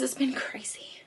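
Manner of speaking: hushed whisper. Real, not acted. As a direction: breathe it out as a secret. Repeated aside, then said plainly.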